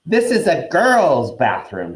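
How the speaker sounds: put-on high, slow, wailing ghost girl; ghostly Myrtle. Myrtle to the boys in her bathroom, suspicious and wailing. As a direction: drag it out like a sulky ghost.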